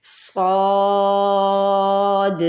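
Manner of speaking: صاد held long, heavy and hissing, no lip rounding; drawn-out heavy saad. Shown as a demonstration. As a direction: stretch صاد on a long open alif with a whistling ص. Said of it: "تب یہ صاد ادا ہوتا ہے".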